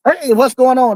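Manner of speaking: high, loud friendly call; calling out to niece. Real, not acted. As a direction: shout a hello across the room. Greeting his niece.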